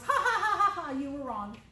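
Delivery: mock laughing taunt, loud at first. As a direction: laugh at him, hammed-up. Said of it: "Do you think he laughed at Thomas?".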